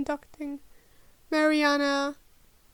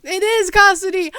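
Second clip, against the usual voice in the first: loud triumphant realization; delighted discovery; excited; 4 semitones higher, 11 dB louder than usual. Real, not acted. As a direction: shout it out happily. Excited at recognizing word.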